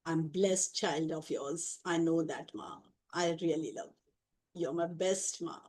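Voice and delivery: tender devotion. Real, not acted. Devotee speaking softly to the divine mother, tender. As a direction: murmur your love slowly to her.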